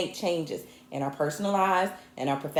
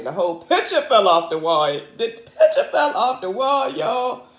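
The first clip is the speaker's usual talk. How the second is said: loud laughing dismay, rising higher; exclaiming; 4 semitones higher, 5 dB louder than usual. Real, not acted. Telling regulars the picture fell, exclaiming.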